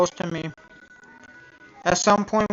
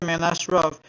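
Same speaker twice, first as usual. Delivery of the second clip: plain reading voice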